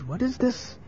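high, soft worried question. Acted. Mother hears what her son is doing, worried. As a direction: ask it in hushed alarm. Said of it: "was very worried".

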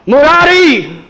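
high, loud call across the house. Retold or imitated. Nimai bursting in and calling Murari, high and loud. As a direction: call his name from the doorway. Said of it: "called out".

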